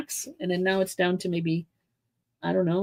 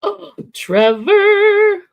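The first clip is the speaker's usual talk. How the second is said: excited; 12 semitones higher, 10 dB louder, much slower than usual. Real, not acted. Sudden excited greeting of the cat.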